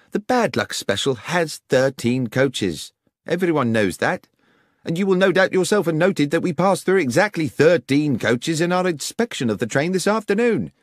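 high, insistent police inspector; objecting inspector. Acted. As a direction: object firmly, pitch climbing. Voices inspector objecting to Holmes.

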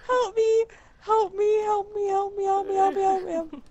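panicked pleading. Real, not acted. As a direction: plead over and over in a small whine. Pleading while chased in game.

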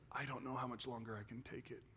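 hushed, understated imitation of his crying wife; quiet confession at breaking point; retold tearful plea. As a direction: say it very softly, as if worn out. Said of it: "and she's crying".

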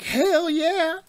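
pumped-up fist-pump shout; hyped hell yeah. Retold or imitated. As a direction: cheer like a fan at the big team-up. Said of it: "I'm like".

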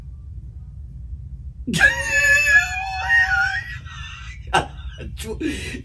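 high helpless laughing burst; laughing fit. Real, not acted. Husband cracking up mid-argument with his wife, helpless laughter. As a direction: let the laugh break out high.

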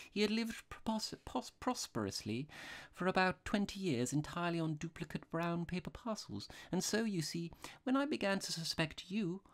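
high-pitched, mild priest; artless little priest. A narrator's reading. Priest explains his suspicion to the robber, artless.

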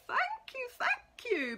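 high, squeaky thank yous; grateful mouse. Acted. Mouse shaking the pig's hand, thanking him in a very high voice. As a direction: thank him over and over, tiny and delighted.

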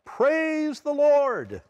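high, slow, drawn-out praise; high, slow joyful praise cry; joyful shout. Retold or imitated. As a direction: lift it up like a shout of joy. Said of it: "raise her arms and shout".

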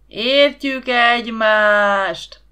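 booming, slow crowd shout; thundering chorus answer; crowd roaring back. A narrator's reading. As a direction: roar it back like a whole crowd. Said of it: "Meglepő hangerővel dübörgött a válasz".

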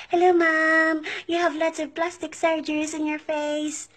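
mock-polite Filipino accent, delivering a jab; imitated Filipino salesgirl. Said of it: "they will say".

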